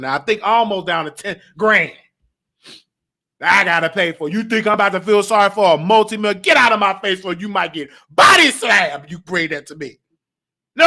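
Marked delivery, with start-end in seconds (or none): none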